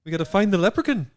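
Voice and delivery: a hammy Irish lilt that climbs at the end of the line; bad Irish accent. Shown as a demonstration. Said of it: "anytime I try to do the Irish accent, I always go up at the end".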